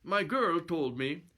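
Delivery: high, slow mock-Swedish; stubborn Swedish bluster, drawn out; Swedish brute. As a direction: protest in a comic Swedish accent. Swede continues his protest.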